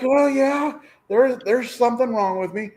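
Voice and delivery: retold squeaky self-mocking agreement; high-pitched comic admission. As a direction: own up in a high, silly voice.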